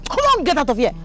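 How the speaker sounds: high, sharp order to leave; scolding cry; angry dismissal. Acted. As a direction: chase them off, pitch jumping up. Chasing off the caught girl.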